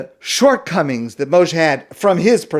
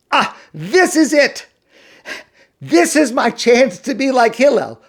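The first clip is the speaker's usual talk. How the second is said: excited; 8 semitones higher than usual. Retold or imitated. Imagines his chance before Shabbos.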